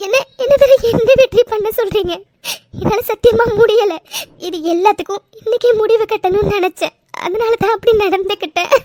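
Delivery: tearful, with sobs between phrases; crying. Acted. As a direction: pour out your hurt through sobs. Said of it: "please, நீங்க அழாதீங்க".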